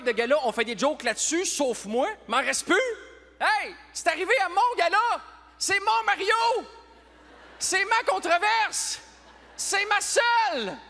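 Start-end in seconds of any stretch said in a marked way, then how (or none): 3.4-10.8 high-pitched, drawn-out possessive protest; mock indignation. Gala host claiming his controversy from the audience, mock indignation. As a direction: wail it slowly like a wronged diva.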